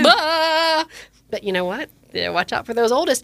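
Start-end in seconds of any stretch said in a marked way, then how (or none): 0.0-0.8 loud, high, drawn-out "bah" of shock; comic startled wail; mock shocked cry. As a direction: imitate someone freaked out, exaggerated. Shoppers' shock at talking baby.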